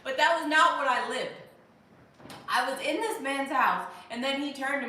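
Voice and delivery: indignant loud storytelling; loud protest. Real, not acted. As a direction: push back on the joke loudly.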